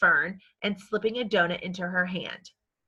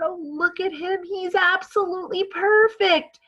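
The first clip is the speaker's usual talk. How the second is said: lightly voiced girl, higher and delighted, not whispered; a girl's delight; 10 semitones higher, 5 dB louder than usual. Girl Fern adoring the tiny pig, high delighted voice. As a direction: coo over him like a new treasure. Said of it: "she whispered".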